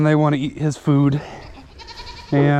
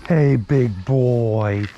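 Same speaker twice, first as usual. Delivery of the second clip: drawn-out affectionate dog talk; slow fond greeting; 4 semitones lower, slower than usual. Real, not acted. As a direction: greet the big dog slowly.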